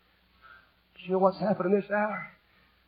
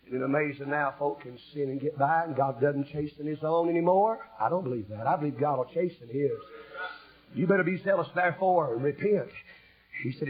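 normal talking voice

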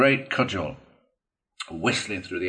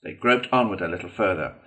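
usual speaking voice

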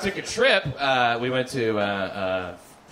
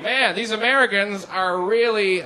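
tourist voice; 7 semitones higher, 4 dB louder, slower than usual. Retold or imitated. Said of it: "Then you'd be like".

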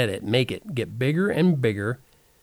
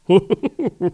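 loud laugh demo; 6 semitones higher, 6 dB louder than usual. Shown as a demonstration. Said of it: "However you laugh".

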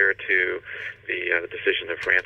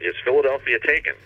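higher-pitched storyteller's yelled question; mild retold yell; 6 semitones higher than usual. Retelling Franklin asking the messenger for news, mild yell. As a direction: call out the question, without full force. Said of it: "And Franklin yells out".

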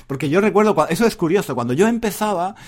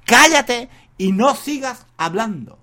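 higher, louder command cállate; imperative shout; 6 semitones higher, 5 dB louder, slower than usual. Shown as a demonstration. Teacher demonstrating the imperative to students, loud command. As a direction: snap the order like a demo of the imperative. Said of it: "Tenéis que usar el imperativo".

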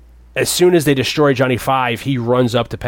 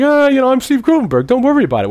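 high, hammed-up carefree voice, louder; mock carefree Gutenberg; 13 semitones higher, 6 dB louder, faster than usual. Imitating Gutenberg's character shrugging off danger, high and hammy. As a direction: toss it off like nothing matters, way up high. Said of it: "He's kinda like".

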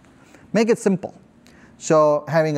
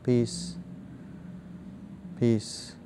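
hushed, fading word repeated slowly; soft repeated mantra; 2 semitones lower, 11 dB quieter than usual. Shown as a demonstration. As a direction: let each peace drop softer and slower. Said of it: "repeat it in your mind".

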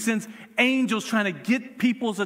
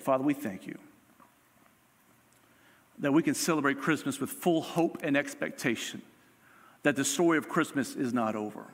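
prayer; 3 semitones lower, faster than usual. Acted. Closing prayer.

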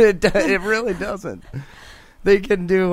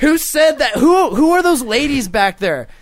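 mock indignant; 7 semitones higher, 4 dB louder than usual. Mock address to hecklers.

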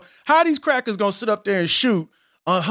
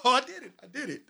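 breathless giggle fading to near nothing; soft triumphant chuckle; quiet proud laughing; 4 semitones higher, 10 dB quieter, faster than usual. Real, not acted. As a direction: laugh at yourself after pulling it off. Laughs, proud after rapping.

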